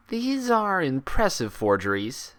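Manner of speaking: a sharp, disbelieving unicorn insisting she's sane; Twilight's voice. Acted.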